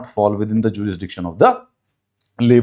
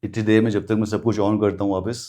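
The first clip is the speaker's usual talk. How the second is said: ordinary talk, nothing marked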